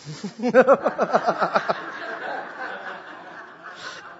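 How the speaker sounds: long sheepish giggle; embarrassed laugh. Real, not acted. Pastor laughs at himself for rushing the sermon, sheepish giggle. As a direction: laugh it off, caught out. Embarrassed at talking too fast.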